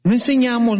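usual speaking voice

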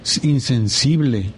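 normal talking voice

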